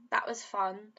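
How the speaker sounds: usual speaking voice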